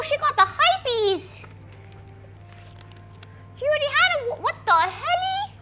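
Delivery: high, squeaky voice for the puppy; pet baby talk. Acted. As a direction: coo at a silly dog in disbelief. Baby-talks to her dog.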